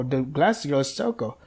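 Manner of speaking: ordinary talk, nothing marked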